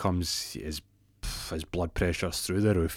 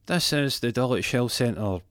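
slow, higher automated-greeting voice; measured, pitched-up recorded greeting; recorded phone message; 4 semitones higher, slower than usual. Acted. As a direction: announce it like a health centre answerphone.

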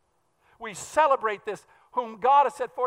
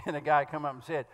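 normal talking voice

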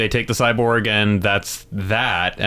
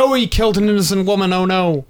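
mock alarm; 10 semitones higher, faster than usual. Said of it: "It's like".

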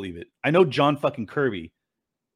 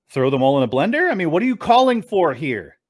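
ordinary talk, nothing marked

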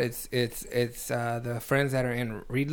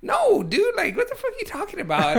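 high-pitched mock protest, half laughing; incredulous denial; 6 dB louder than usual. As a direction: retell it as a squeaky, baffled denial. Acting out startled denial to friend.

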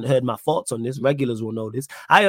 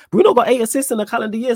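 surprised; 10 semitones higher, 5 dB louder, faster than usual. Real, not acted. Surprised by a stat.

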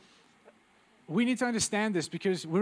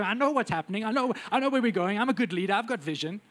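loud, puffed-up boast, hammed up; mock self-assured leader; 4 semitones higher, 4 dB louder than usual. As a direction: play the know-it-all leader who bluffs. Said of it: "and we go".

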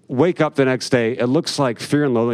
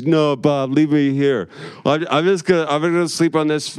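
mock drunk dad; 5 semitones higher than usual. Drunk dad pleading on street.

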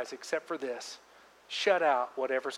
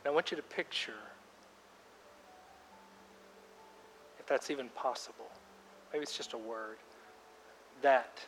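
guided prayer; 10 dB quieter than usual. Acted. Pastor guiding congregation in silent prayer, softly. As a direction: lead them gently into quiet prayer. Leads a quiet prayer time.

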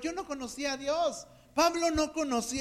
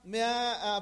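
normal talking voice

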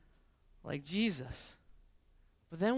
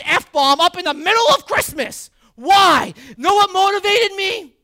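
loud, high, driving sermon voice; preaching at full force; 8 semitones higher, 12 dB louder than usual. Acted. Pastor urging congregation to pursue wisdom, full-force preaching. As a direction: preach it hard to the room.

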